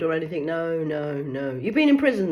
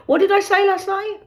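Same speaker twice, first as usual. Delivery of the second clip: higher, sugary polite question to caller; sweet phone voice; 12 semitones higher, 6 dB louder than usual. Acted. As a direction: ask brightly, hiding the worry.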